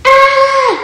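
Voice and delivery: high, loud scream; scream for life. Acted. Bert seized by the magpie, screaming for his life. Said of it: "Bert schreit um sein Leben".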